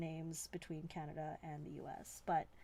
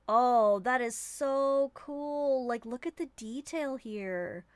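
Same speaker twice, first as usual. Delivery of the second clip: a loud, drawn-out oh, that is so cool; real delight over the glitter, slow and loud; excited gasp; 4 semitones higher, 8 dB louder, slower than usual. Real, not acted. Thrilled by the ink detail, a loud slow excited exclamation. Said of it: "You're really excited".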